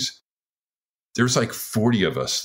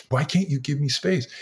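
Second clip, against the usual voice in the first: higher, pleading protest at normal volume; mock upset yelling; 7 semitones higher than usual. Wife asking her following husband for space, retold exasperation. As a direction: imitate an exasperated wife without shouting. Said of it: "she's crying and yelling".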